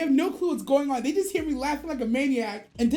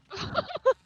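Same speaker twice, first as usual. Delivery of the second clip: high laugh; 10 semitones higher than usual. Real, not acted. Laughing at brother reveal.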